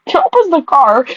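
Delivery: rereading the line through a laugh; laughing while reading. Real, not acted. Reader laughing at her own odd sentence. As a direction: giggle out the words as you reread. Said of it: "Am I reading right?".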